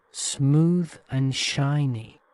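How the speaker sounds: ordinary delivery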